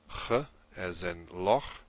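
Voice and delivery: "ch" as the throat fricative in loch; guttural ch. Shown as a demonstration. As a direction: slowly sound out "ch" as a continuous rasp, as in "loch". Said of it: "ch is a sound that".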